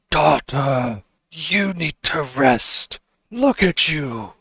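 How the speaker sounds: a hint of a worried dad urging rest; understated fatherly concern, slowing down; father's worried voice.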